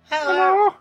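helium voice. Shown as a demonstration. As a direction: pitch it way up like helium. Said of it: "do your best helium voice".

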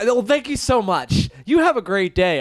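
high, effusive thank-you; imitating a friend being extra nice to waitstaff; overly polite customer. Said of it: "Joe interacting with waiters and waitresses".

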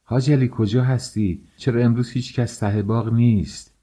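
projected question, meant to be overheard; fairly loud calling. A narrator's reading. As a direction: call out across the garden. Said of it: "به صدای نسبتاً بلندی گفتم".

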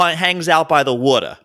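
lightly put-on Jersey Italian accent; New Jersey Italian accent. Imitating a friend from Jersey, light Italian-American accent. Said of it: "a lot of people have adopted the Italian accent".

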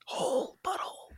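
mock ASMR porn whisper in a hillbilly voice. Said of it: "a hillbilly".

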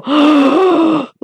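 a sharp indrawn gasp, like a whole arena holding its breath; imitated crowd gasp. Vlogger imitating the crowd's shock at the burn, sharp gasp. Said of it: "you heard the crowd go".

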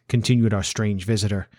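plain reading voice

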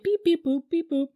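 high, chirpy beep-boop robot noises; a light imitation of a boy's robot beeps; little robot noises. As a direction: play a kid making toy robot sounds. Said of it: "little robot noises".

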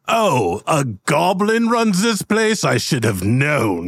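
very high, slow indignant demand; huffy dwarf. Acted. Dwarf customer demands a refund, high and indignant. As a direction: puff up and demand the manager.

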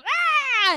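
high piercing battle scream; Frieza scream. Retold or imitated. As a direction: shriek like a powering-up villain. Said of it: "Frieza does a Frieza scream".

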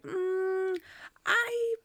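high, held hedging hum; imitating someone squirming out of an answer; reluctant hesitation. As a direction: stall with drawn-out sounds. Monk hesitates over answering.

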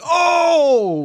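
loud high startled yelp; mock yell. [name] re-enacting his yell at the spider, loud high yelp. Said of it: "I was like".

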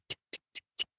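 breathy whispered tick tick tick. Retold or imitated. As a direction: whisper the ticks like a pounding pulse. Racing heartbeat, panic rising.

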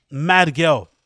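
normal talking voice

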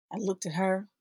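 normal talking voice

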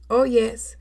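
ordinary talk, nothing marked

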